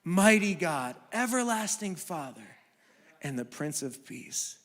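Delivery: low, soft, solemn reading; solemn preaching. Acted. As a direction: let the names sink in reverently. Preaching over music.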